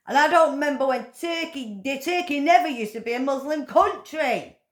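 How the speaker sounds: high, loud furious ranting; shouting down the king in rising fury; angry rant. Real, not acted. Ranting to the camera about King Charles, high and loud with fury. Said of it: "I've had my little rant".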